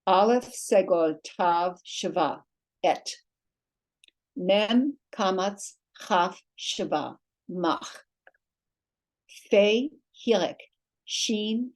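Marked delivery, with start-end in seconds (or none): 0.1-3.1 each part of "Aleph segol tav shva" drawn out, then "et" said as one syllable; slow spelling demo. Shown as a demonstration. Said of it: "Let's say this one syllable". 4.4-8.0 "Mem kamatz chaf shva" very drawn out, then "mach" as one syllable; slow spelling demo. Shown as a demonstration. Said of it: "Let's say this one syllable".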